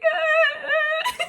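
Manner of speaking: high, loud crying wails; crying out over a sad K-drama; anguished wails. Real, not acted. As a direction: wail like the show broke you. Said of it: "I'm sitting here like".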